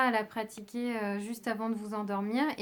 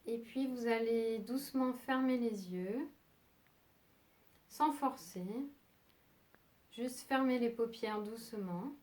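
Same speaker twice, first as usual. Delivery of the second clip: soft, unhurried meditation voice with long pauses; calm yoga nidra guidance, leaving long silences; guided meditation; 4 dB quieter than usual. Acted. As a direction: guide them gently towards sleep, phrase by phrase.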